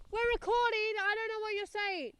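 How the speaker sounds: high shrill imitation of mum; mock mum voice. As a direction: play the nagging mum. That's me going off at my mum.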